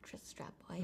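hushed whisper. Real, not acted. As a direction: breathe the name softly. Whispered name.

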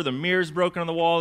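normal talking voice

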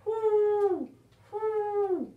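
high hooting whoo; high sing-song owl call. Shown as a demonstration. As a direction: hoot like an owl for the kids. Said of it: "Everybody whoo like an owl with me".

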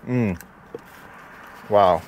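muffled words between bites; talking while chewing. Real, not acted. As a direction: mouth partly full of food. Seen 0: Bit torta at -3; mouth closed and working while saying words.

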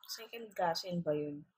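normal talking voice

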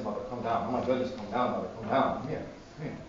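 low, hushed calming voice; gentle soothing. Retold or imitated. Saint calming a screaming nun, gentle and hushed. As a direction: coax softly, dropping almost to a whisper at the end.

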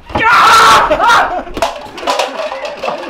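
loud high yell breaking into laughter; startled scream. Real, not acted. Player startled during the hunt, real scream into laughter. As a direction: scream in shock, then crack up laughing.

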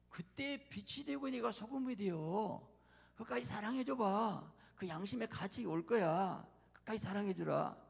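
high, insistent exhortation; urging divine voice. Acted. God urges a betrayed believer to keep loving, insistent.

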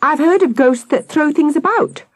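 high-pitched, excited theorizing; shouted. A narrator's reading. Victoria explaining the ghost, high excited shout. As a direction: rattle it off high and eager. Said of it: "Victoria shouted".

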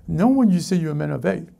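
normal talking voice

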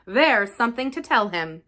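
ordinary delivery

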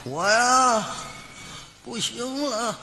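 lightly voiced dying old man; drawn-out last words, trailing off weakly; a hint of a failing father; dying father's last words.

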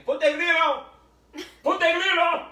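put-on loud, high Latin-accented yell; Latin accent shout. Retelling Jose demanding the grill, loud Latin accent. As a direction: belt it out in a thick Latin accent. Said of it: "he's got this great Latin accent".

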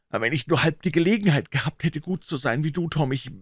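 high, rushed sobbing lament; tearful. A narrator's reading. Huck envies Tom's chance, lamenting high and fast. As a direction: rush it out through acted tears. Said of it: "Auch Huckleberry schluchzte nun".

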